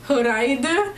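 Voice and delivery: "huraide", fried with an h for the f; "huraide" in three clear syllables, Korean style; Korean-style pronunciation. Shown as a demonstration. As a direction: demonstrate "huraide" as hoo-rai-duh. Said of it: "for fry they use H".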